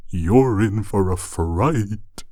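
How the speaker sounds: slow, drawn-out spooky tease; mock spooky warning. Teasing the roommate before the horror movie, mock spooky and slow. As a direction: ham up the horror-host menace, playfully.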